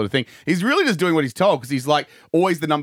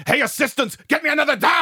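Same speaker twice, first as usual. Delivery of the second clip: high, hammed-up yell; mock bossy call; 12 semitones higher than usual. [name] to his assistants, mock bossy shout. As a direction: call out a pretend order, higher.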